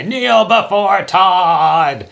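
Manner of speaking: very high, loud, slow bellow; yelling to the sky. Retold or imitated. A bullied kid with super strength yells skyward, a loud slow bellow. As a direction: roar it slow and high to the heavens. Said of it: "yells into the sky".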